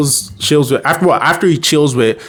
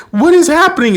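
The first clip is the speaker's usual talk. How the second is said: much higher, louder bewildered cry; mock terror; panicked; 4 dB louder than usual. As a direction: yell it mid-swing. Ben swung around in panic.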